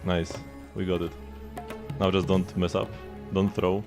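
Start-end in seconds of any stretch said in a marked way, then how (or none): none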